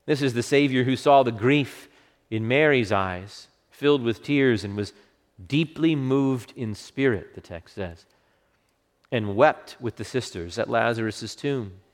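ordinary talk, nothing marked

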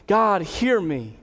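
"God, hear me" slow, each word weighted; drawn-out, emphatic "hear me"; emphatic plea. Acted. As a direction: lean hard on "hear" in "God, hear me". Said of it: "He is emphatically saying".

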